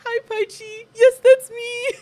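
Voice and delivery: re-enacted high, starstruck greeting; giddy. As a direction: act out giddy shyness turning loud. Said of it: "I was so giddy about it".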